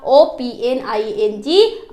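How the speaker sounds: slow letter-by-letter spelling O P N I N G; spelled out. Shown as a demonstration. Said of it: "only one N होना चाहिए था". (धीरे-धीरे अक्षर-अक्षर spelling)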